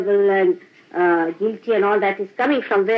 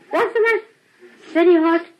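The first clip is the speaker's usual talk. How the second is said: mock dialogue; 9 semitones higher, 4 dB louder than usual. Acted exchange, high pitch.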